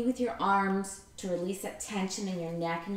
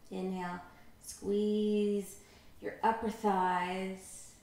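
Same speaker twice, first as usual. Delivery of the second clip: guided relaxation; 5 dB quieter than usual. Acted. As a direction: calmly guide each squeeze and release. Leads muscle relaxation.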